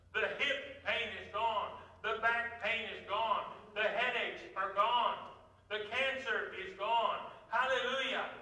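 high, loud shouts of glory hallelujah; joyful praise. Acted. Preacher acting out a healed believer praising God, exultant and loud. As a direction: burst out rejoicing over a healing. Imagined joyful healing testimony.

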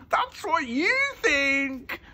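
very high, drawn-out taunt; high-pitched mocking comeback; gleeful scoff. A narrator's reading. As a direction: sing out a squeaky, slow retort. Stanley silently scoffs at thieves.

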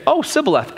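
Sibboleth with a plain S instead of SH; lisped Shibboleth. Retold or imitated. Fugitive Ephraimite trying to pass, Sibboleth with plain S. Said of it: "And they'd say".

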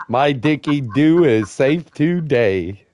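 drawn out slowly, smug and deliberate; slow, savoring quote. Retold or imitated. Asking a friend back slowly and smugly. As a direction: let each word land slowly, like a taunt. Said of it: "Did you tell him".

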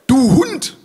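high, enraged insult; furious outburst. Acted. Ulf insults Otto, furrowed with fury. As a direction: burst out in rage. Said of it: "brummt Ulf wutdurchfurcht".

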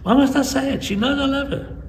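high-pitched, loud mock protest; exasperated retold objection; indignant husband. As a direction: imitate a stubborn husband refusing, voice way up. Husband balks at saying I love you.

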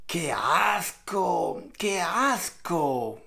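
slow, soft disgusted groan. Real, not acted. Reacting to the socks story, slow soft disgust. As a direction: drag out the disgust.

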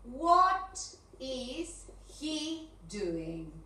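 very slow, separated words; stretched question with a gap after each word; word-by-word dictation. Shown as a demonstration. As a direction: dictate it one word at a time.

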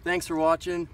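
loud, high, cheerful outro; upbeat sign-off. Real, not acted. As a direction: wrap up the video with energy.

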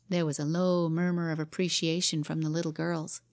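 usual speaking voice

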